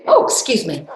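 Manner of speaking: plain reading voice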